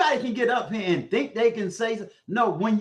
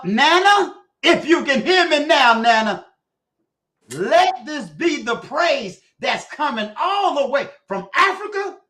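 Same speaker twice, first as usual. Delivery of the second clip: much louder, much higher call across the ocean; 6 semitones higher, 7 dB louder than usual. Acted. Uncle calling out to Nana in Africa, loud call. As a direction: shout it so Nana hears far away.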